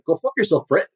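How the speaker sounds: clipped kiwi try at the insult; New Zealand accent, slightly off; New Zealand accent demo; New Zealand accent attempt. Shown as a demonstration. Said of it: "I can say certain things like".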